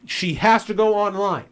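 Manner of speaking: real anger boiling over; furious rant. Real, not acted. Ranting about the store clerk.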